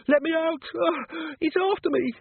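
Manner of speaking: high, loud terrified cry; panicked scream. Acted. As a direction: shriek in terror. Terrified man fleeing.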